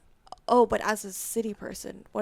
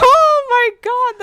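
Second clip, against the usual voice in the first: dramatic oh my God; 14 semitones higher, 20 dB louder, slower than usual. Real, not acted. Realizing she sounds basic.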